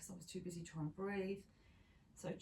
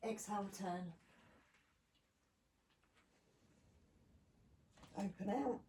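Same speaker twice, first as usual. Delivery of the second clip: strained voice; 3 semitones higher than usual. Real, not acted. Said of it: "My voice box has gone".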